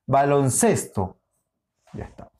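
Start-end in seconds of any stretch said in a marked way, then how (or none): 0.1-1.2 slow baloncesto, leaning on the syllable ces; stress on CES. Shown as a demonstration. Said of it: "pero ces sí". (baloncesto lento, marcando ces)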